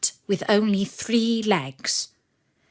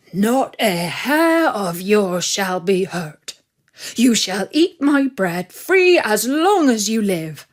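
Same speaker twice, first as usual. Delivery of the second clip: grateful farmer; 5 dB louder than usual. Acted. The father promising Sultan bread for life, grateful and loud. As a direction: praise the old dog with big heartfelt gratitude.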